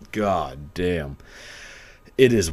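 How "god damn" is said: normal talking voice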